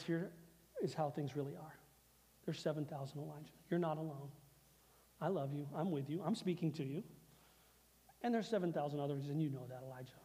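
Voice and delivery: soft, low, gentle reassurance; tender voice of God. Acted. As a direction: speak softly as a loving God comforting him. God speaking tenderly to Elijah.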